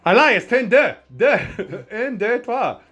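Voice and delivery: high, excitable mock French; playful French accent. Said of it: "I'm more French each time".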